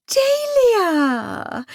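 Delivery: high drawn-out cry of a name; gushing fake delight. A narrator's reading. Primula hailing her rival with high false joy.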